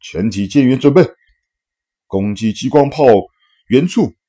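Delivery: loud, high battle order; shouted command. A narrator's reading. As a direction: bark the order to the crew. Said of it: "极度气恼地吼道".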